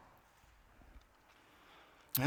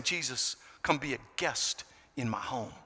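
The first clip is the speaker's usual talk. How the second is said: hushed prayer; 5 semitones lower, 8 dB quieter than usual. Retold or imitated. Soft quoted prayer.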